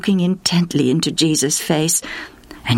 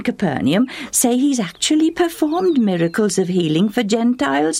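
lightly voiced neighbour woman, higher; gossipy friend, a touch higher; chatty village woman; 8 semitones higher than usual. Neighbour woman urging Justa to bring her daughter, lightly voiced higher.

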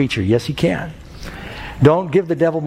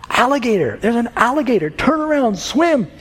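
high-pitched acted cry of alarm; frantic warning yell; 10 semitones higher than usual. Mother warning her son about the alligator, acted frantic yell. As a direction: call out a warning, pitch leaping way up, no real volume. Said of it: "and yelled".

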